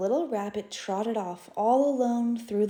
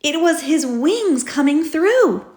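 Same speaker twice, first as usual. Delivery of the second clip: excited reveal; 7 semitones higher, 6 dB louder than usual. A narrator's reading. Narrator reveals the wings.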